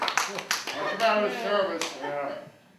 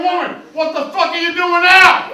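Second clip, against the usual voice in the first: outraged yell; 10 semitones higher, 7 dB louder than usual. Acted. Outraged shout again.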